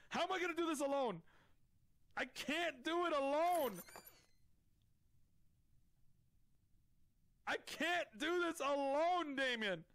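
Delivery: high, slowed pleading lament; mock despair. Real, not acted. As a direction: wail it like all hope is lost. Begs dead teammate Damien.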